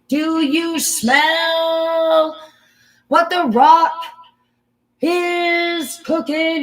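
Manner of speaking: high, drawn-out wrestler's bellow; wrestling catchphrase. Acted. Hyping a crowd as a wrestler, drawn-out bellowed catchphrase. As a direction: belt it out, stretching every word.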